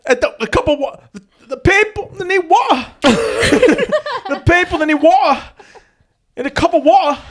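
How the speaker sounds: very high-pitched mock Cockney; badly faked British, squeaked way up; bad British accent. Shown as a demonstration. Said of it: "I don't think that's a good British accent".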